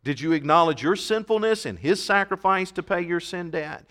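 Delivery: ordinary talk, nothing marked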